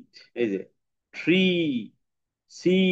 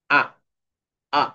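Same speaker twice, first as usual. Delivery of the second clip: ordinary talk, nothing marked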